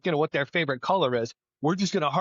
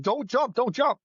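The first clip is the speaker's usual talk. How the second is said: retold yelling; 8 semitones higher than usual. Firefighter warning people on the fire escape, mock yell. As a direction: imitate an urgent warning call, pitch jumping up. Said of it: "started screaming and yelling at them".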